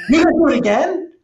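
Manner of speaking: shocked onlooker asking in high, quick disbelief; incredulous exec. Retold or imitated. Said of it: "And he went".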